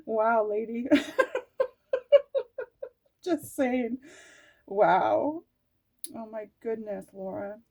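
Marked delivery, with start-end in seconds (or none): 1.9-2.9 a sudden high squeal of a laugh; high burst of laughter. Real, not acted. Tarot reader laughing at her card, high squeal. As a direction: let out a high delighted laugh.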